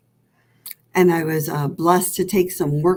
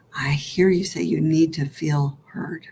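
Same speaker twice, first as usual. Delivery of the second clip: whispered comfort from a loving inner mother; tender whisper; 3 semitones lower than usual. Pretend. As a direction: soothe yourself in a hush. Mother self answers softly.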